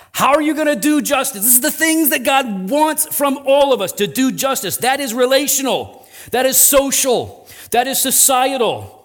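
emphatic, insistent sermon delivery; impassioned preaching. Real, not acted. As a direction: press each point on the congregation. Preaches with force.

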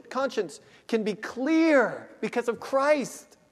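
normal talking voice

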